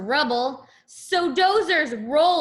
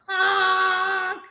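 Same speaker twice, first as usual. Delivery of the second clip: horn honk; 6 semitones higher than usual. Acted. Cement mixer truck blaring its horn call. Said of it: "gives his horn a blaring blast".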